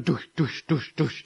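soft, low rhythmic doosh sounds; imitated helicopter blades.

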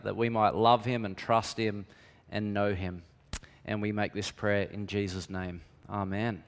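soft, reverent prayer voice; hushed church prayer. Real, not acted. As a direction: pray quietly over the congregation. Prays before the sermon.

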